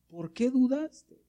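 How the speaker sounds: high, drawn-out question; reproachful question. Acted. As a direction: ask it slowly, pressing the doubter. Repeats Jesus's question to Peter.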